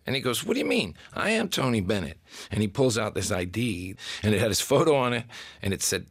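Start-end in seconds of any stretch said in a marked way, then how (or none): 0.4-2.2 indignant insistence. Retold or imitated. Stranger insists he is [name].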